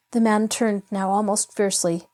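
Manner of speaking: usual speaking voice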